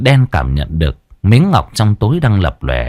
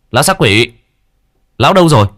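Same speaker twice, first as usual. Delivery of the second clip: louder, rising urgent shout; calling louder; 5 semitones higher, 3 dB louder, much faster than usual. A narrator's reading. Exorcist shouting for his vanished companion, louder calling. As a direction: yell for the missing ally. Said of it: "Hắn lớn tiếng gọi".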